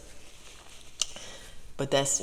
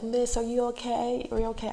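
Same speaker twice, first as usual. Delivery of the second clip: concerned stranger; 9 semitones higher than usual. Retold or imitated. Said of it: "she's like".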